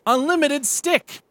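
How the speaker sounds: high and slow, grand announcement; waitress voice. Acted. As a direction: present the dish like a wonder. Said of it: "Waitress says".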